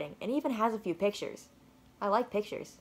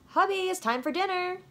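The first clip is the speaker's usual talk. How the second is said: higher calling mother's voice; mom calling; 10 semitones higher than usual. Acted. Mom calls son to dinner, higher calling voice. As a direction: call him to dinner like mom.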